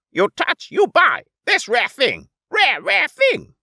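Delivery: high, loud broken-English haggling; pushy merchant creature. Acted. Little merchant twin pushing the alien to buy. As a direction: press the sale in clipped pidgin.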